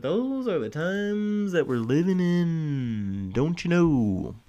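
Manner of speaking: slow, drawn-out put-on posh accent; mock posh accent. Vlogger wrapping up dark news in a slow mock posh accent. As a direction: stretch it out in a lightly mocking posh accent.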